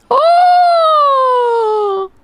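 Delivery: long, high, loud "oh"; excited squeal. Real, not acted. Streamer excited about the next song, high squeal. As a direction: let out a sustained thrilled squeal. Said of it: "I'm so excited".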